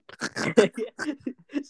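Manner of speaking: real high-pitched laughter; helpless laugh. Real, not acted. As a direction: crack up at a friend's slip. Laughs at the exorcism slip.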